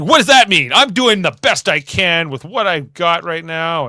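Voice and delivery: hammed-up hurt defensiveness; mock defensive Troy. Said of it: "She's like".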